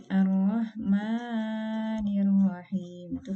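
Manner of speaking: Ar-Rahmanir Rahim slowed, the long vowels held four counts; overlong mad demo. Shown as a demonstration.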